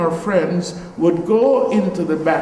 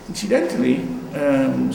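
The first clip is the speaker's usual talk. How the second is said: ordinary delivery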